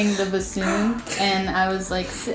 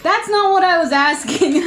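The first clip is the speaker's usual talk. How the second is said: quoted retort, breaking into laughs; conductor's reply, laughing; laughing while quoting; 7 semitones higher, 5 dB louder than usual. Retold or imitated. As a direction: say it through a laugh.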